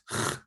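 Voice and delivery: "ch" as the rasping throat sound of loch; Scottish ch. Shown as a demonstration. Teacher introducing a harder consonant, "ch" as in Scottish loch. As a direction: scrape "ch" at the back of the mouth. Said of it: "like in Scottish loch".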